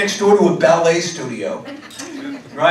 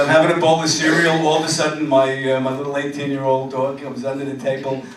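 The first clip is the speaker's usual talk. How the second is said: ordinary talk, nothing marked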